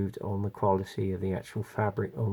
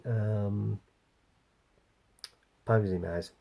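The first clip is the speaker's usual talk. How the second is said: ordinary talk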